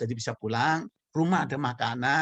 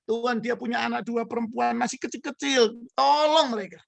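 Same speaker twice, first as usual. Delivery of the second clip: high, pleading prayer through tears, voice much higher; retold tearful prayer; 9 semitones higher than usual. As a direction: cry out to God for this family. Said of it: "Karena saya nangis".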